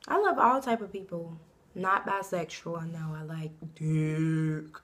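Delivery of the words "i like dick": whispered low, drawn out slowly; whispered confession. Shown as a demonstration. Confessing to viewers, slow whisper. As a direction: breathe it out slowly and secretly. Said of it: "Let me say that again".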